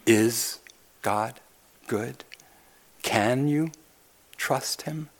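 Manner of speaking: a hint of a sly serpent, quiet and doubting; lightly voiced tempter sowing doubt; hushed serpent voice. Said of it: "The serpent still speaks".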